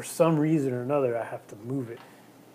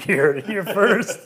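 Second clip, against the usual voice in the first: laughing; 6 semitones higher, 6 dB louder, slower than usual. Real, not acted. Joking after murder boast.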